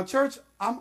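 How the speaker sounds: ordinary delivery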